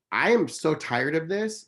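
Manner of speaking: high, slow, exasperated retelling; upset, fed up. Retold or imitated. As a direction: drag it out in fed-up frustration. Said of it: "I got so upset".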